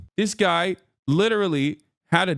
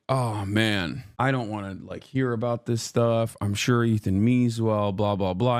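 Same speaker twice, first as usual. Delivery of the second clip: lazy dismissive mumble; 9 semitones lower than usual. Retold or imitated. Retelling his own dismissive past self.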